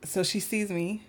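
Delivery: ordinary talk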